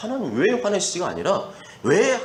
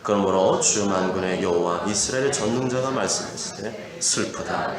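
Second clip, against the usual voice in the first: lower, steady solemn reading of scripture; 7 semitones lower than usual. Acted. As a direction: read the verses low and measured for the congregation. (낮고 엄숙한 성경 봉독)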